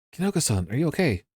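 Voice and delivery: ordinary talk, nothing marked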